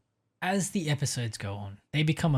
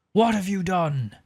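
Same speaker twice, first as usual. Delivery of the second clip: angrier demand; 7 semitones higher, 4 dB louder than usual. Acted. Fisk repeats, knocking chair over.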